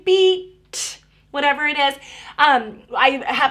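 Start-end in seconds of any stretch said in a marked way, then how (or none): 0.0-1.0 "beat" high and loud with the final "t" split off; ending sound set apart. Shown as a demonstration. Parent adding the ending car on the train, final "t" in "beat" popped. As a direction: call out "beat", then pop a separate "t". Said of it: "put that ending car on".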